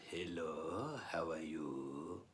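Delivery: low, soft, drawn-out Yinglish greeting; Yinglish accent. Acted. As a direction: stretch it out slowly in a deep Yiddish-English accent. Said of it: "he speaks Yinglish".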